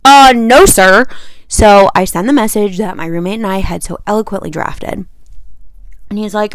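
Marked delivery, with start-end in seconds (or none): 0.0-1.0 louder, flat-out mock no sir; sassy loud no; emphatic refusal. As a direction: shut it down firmly. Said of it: "And I'm like".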